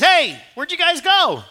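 high, loud, slowed call of a searching God. Acted. God calling through the garden for Adam and Eve, high and loud. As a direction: call out like you can't find them.

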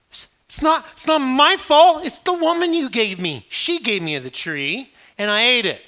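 put-on high, loud, childish whine; whining Adam. Adam whining to God that it's not his fault, high and loud. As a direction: whine like a caught kid.

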